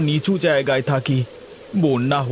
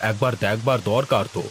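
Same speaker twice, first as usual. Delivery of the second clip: lower, heavier landlord voice; zamindar voice; 6 semitones lower, 3 dB quieter than usual. Acted. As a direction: speak down as the village landlord. Same actor voices the zamindar.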